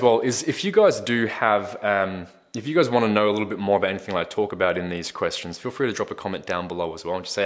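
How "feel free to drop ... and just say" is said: ordinary delivery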